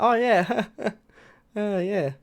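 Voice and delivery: high, absent-minded agreement with a fake chuckle; hollow, high-pitched "oh yeah"; pretending to listen. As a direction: laugh along without having heard a word. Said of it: "you don't listen".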